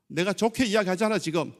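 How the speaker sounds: loud mock scolding; acted wifely warning. Wife warning husband to come to church, acted scolding. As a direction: play an exasperated wife, raising your voice.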